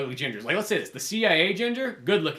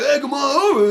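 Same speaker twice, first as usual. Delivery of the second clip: high, louder, hammed-up come-on; sleazy invite; 9 semitones higher, 6 dB louder than usual. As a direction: lure them over like a creep. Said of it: "He's like".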